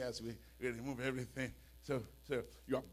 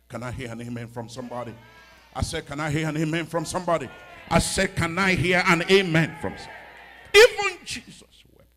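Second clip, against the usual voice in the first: amen call building to a shout; rally the crowd louder and louder; full-force preaching; 4 semitones higher, 19 dB louder than usual. Real, not acted. Rallies congregation for amen.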